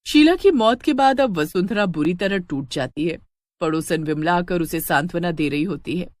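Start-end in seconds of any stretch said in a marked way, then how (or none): none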